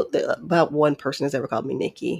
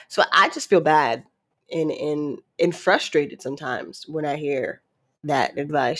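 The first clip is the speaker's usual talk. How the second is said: usual speaking voice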